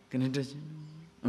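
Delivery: slow, soft, voiced murmur; softly murmured temptation; hushed murmur. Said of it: "why that whispering comes?".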